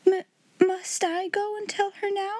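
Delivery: very high, faltering question; panting dismay. A narrator's reading. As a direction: stammer timidly at the order. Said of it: "panted Miss Amelia".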